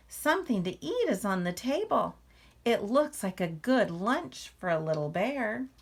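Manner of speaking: lively, expressive read-aloud to a class; reading to children. A narrator's reading. Teacher reading a picture book to the class, lively read-aloud. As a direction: draw young listeners into the story.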